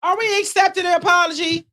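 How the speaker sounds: loud, high challenge; loud demand. Real, not acted. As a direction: call it out loud. Demanding question to the group.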